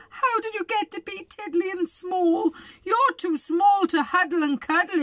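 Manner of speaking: high, wailing storybook voice; acted tearful bawl; bawling bear. A narrator's reading. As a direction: wail it out high, as a big upset bear. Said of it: "could hear the voice bawl".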